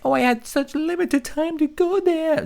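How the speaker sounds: high, mocking whine of her excuse; mocking whiny excuse. Host mocking the streamer's excuse, high whiny mimicry. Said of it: "Blame it now being like".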